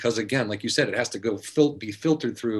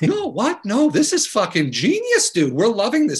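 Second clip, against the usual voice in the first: higher, laughing excited praise; excited reassurance; 6 semitones higher than usual. Retold or imitated. Director reassuring Rodney, higher excited voice. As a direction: gush that he's a genius.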